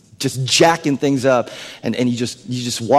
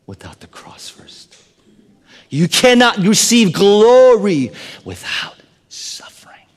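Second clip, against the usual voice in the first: slow, breathy whisper; intense whisper; 5 semitones higher, 3 dB louder, slower than usual. Acted. Preacher telling the congregation about the cross in a slow whisper. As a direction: lean in and hush it gravely. Preacher stresses cost of glory.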